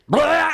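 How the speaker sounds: loud high retching blah; a hammed-up puke noise; mock vomit. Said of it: "And she goes".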